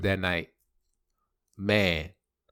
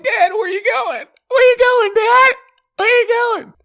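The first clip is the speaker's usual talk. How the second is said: very high loud hammed-up wail; mock wailing; 8 dB louder than usual. Son wailing as his dad walks out, mock high wail. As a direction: call after him like a crying child.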